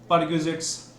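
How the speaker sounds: ordinary talk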